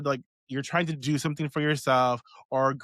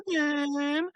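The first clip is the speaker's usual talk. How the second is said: high, mincing cinnamon; mocking a friend's cinnamon sprinkles, high; 12 semitones higher than usual. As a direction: say it like the prissy friend. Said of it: "I have to say it like that".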